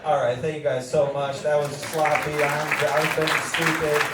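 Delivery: big end-of-set announcement; stage sign-off. Real, not acted. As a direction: belt out the closing thanks and hand-over. Loud sign-off to the crowd.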